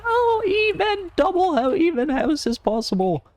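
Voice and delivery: a squeal of stunned disbelief; astonished disbelief. Real, not acted. Teammates survive unexpectedly.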